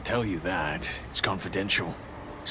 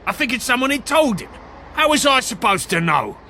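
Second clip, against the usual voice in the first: high, loud and fast justification; agitated; 11 semitones higher, 6 dB louder than usual. Acted. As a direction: protest your innocence. Suspect justifies himself.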